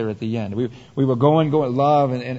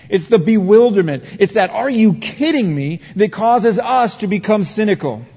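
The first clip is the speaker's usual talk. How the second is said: louder, higher impassioned preaching; preaching at full force; 6 semitones higher, 6 dB louder than usual. Acted. Pastor warning the congregation against shock, impassioned. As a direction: drive the warning home to the church.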